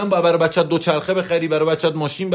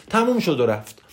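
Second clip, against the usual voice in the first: ordinary talk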